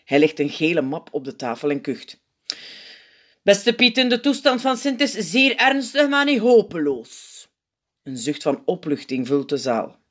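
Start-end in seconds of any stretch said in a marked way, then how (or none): 3.4-7.5 higher-pitched grave doctor figure; zenuwpiet, ernstig. A narrator's reading. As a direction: announce the diagnosis to the hall. (ernstige, hogere zenuwpiet)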